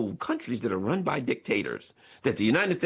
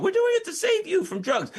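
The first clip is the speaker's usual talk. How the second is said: mock official excuse. Mocking the government's excuse.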